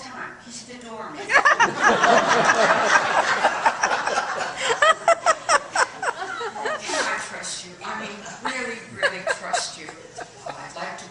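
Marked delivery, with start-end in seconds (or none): 1.3-5.6 very high, loud hysterical laughter; long hysterical cackle; long shrieking laugh. Acted. Laughs at the doorman reveal. 5.7-9.9 high, slow, laughing through the words; high giggly pleading; laughing plea. Acted. Pleading while still laughing.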